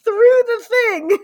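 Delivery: high, loud, crying with laughter; laughing to tears. Real, not acted. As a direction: gasp it out through helpless laughter. Retelling fall, helpless laughter.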